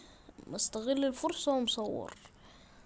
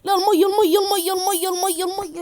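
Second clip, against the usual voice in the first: high, loud repeated cries of water; panicked shouting; 7 semitones higher, 11 dB louder than usual. Real, not acted. Gamer falling into the water, shouting in panic. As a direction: blurt it out in a frantic rush.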